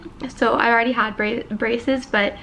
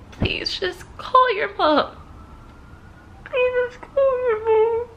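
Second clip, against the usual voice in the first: higher, sobbing, pleading voice; crying plea; 10 semitones higher, slower than usual. Real, not acted. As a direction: beg them through tears, slowly.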